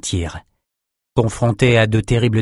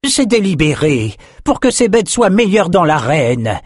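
high-pitched, emphatic sorcerer explaining his design; proud creator's voice, lifted well up; proud flesh sorcerer; 8 semitones higher, 4 dB louder than usual. Acted. Sorcerer explains his arena beasts, proud high-pitched delivery.

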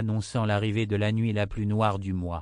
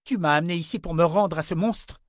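higher, louder exploding accusation; angry outburst; 8 semitones higher, 4 dB louder than usual. Acted. Wife exploding at her husband for betraying her. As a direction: hurl it at him in fury. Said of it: "explosa Callan".

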